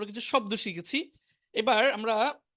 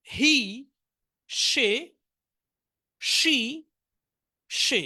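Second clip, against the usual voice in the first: vocabulary drill; much slower than usual. Shown as a demonstration. Teacher reading pronouns with meanings for the class. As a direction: chant each word and its translation.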